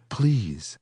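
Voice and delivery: pleading woman. A narrator's reading.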